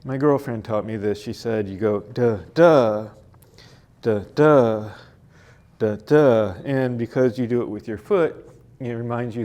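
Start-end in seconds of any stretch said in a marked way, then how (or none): 2.0-6.5 "duh, DUH" with the second duh long and much louder; weak first "duh", punched second "duh", in pairs; iambic stress demo. Shown as a demonstration. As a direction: tap out iambs, hitting every second "duh" hard. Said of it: "an unstressed fit syllable followed by a stressed syllable".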